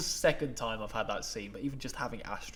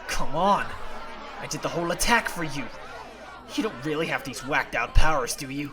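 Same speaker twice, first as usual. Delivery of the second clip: loud, fast exasperated plea; angry frustration; 3 semitones higher, 7 dB louder than usual. Acted. Character angrily demanding answers. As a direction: push back hard, frustrated.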